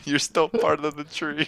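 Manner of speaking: laughing. Real, not acted. Teasing friend, laughing.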